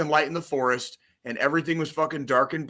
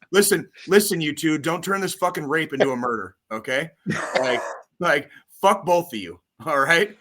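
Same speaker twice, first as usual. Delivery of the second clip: louder, higher, hammed-up telling off; playful rant at two teasing co-hosts; mock anger; 4 semitones higher, 5 dB louder than usual. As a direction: scold both friends half in jest.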